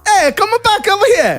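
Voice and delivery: high-pitched fake Spanish accent; mock Spanish accent. Shown as a demonstration. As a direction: call out in a bad Spanish accent. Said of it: "now give me the Spanish".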